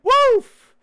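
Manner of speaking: high, loud excited woof; a high, loud woof of delight. Real, not acted. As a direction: bark it out with glee. Excited exclamation.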